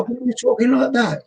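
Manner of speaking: a hint of a bemused mate; mock bafflement. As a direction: pitch jumps up in disbelief.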